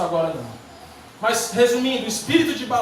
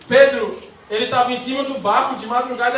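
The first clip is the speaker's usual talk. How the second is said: ordinary talk, nothing marked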